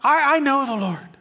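high, loud stammering "I, I know the Lord"; hammed-up confident professor; mock professing believer. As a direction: mimic a shallow claim of faith. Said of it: "if you say".

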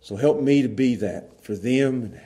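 soft, low prayer voice; closing prayer. Acted. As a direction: pray quietly and humbly.

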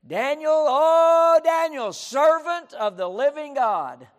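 high, loud, drawn-out call; crying out. Acted. King calling down to Daniel among the lions, anguished cry. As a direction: holler it slowly into the den. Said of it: "he cries out to Daniel".

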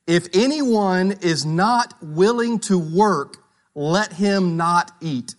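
slow, weighty reading of the verse. Acted. Pastor rereads the verse to the congregation, slow and weighty. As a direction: let each word of the verse land.

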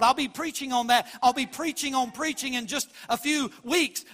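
loud, ringing preacher's proclamation, slowing on key words; a pastor calling preachers to proclaim the truth; preaching at full force. Acted. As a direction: herald it like a trumpet. Heralding section of the sermon.

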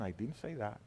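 quiet deflection, almost under breath; softly denying. Real, not acted. Preacher answering an imagined heckler, softly.

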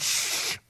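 a sharp unvoiced shush; hushing shh. Crowd shushing the blind beggar, sharp hiss. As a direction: hush him hard. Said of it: "They said".

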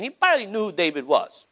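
normal talking voice

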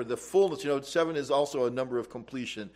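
usual speaking voice

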